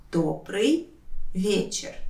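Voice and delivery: «Добрый», pause, «вечер»; careful breakdown of «Добрый вечер»; word by word. Shown as a demonstration. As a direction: say each word separately for learners. Teacher separates words for learners.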